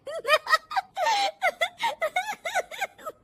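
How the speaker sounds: high shrill-free peals of laughter. Acted. Daughter-in-law laughing hard at the serial. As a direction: cackle at the TV show.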